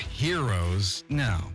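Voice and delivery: normal talking voice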